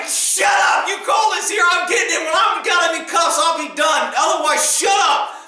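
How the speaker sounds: high-pitched, heated retold retort; worked-up telling-off; furious. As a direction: re-enact snapping at an interfering doctor. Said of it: "I was freaking furious".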